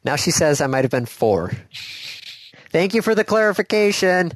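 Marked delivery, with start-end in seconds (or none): 2.7-4.3 raised voice carrying through a door; through a wall. Real, not acted. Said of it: "as I shout through a wall and a door".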